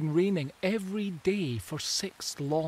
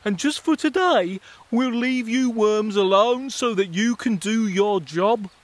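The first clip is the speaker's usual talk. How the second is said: higher, louder mole voice; generous mole; 5 semitones higher, 8 dB louder than usual. Acted. Mole telling the worms it will leave them alone. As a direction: grant the worms a favour.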